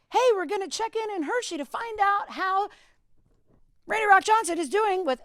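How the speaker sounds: high, bright on-air announcer pitch; radio host voice. Retold or imitated. Young DJ opening the mic for a live interview, upbeat announcer. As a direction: sell it like a DJ going live. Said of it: "So I opened the mic".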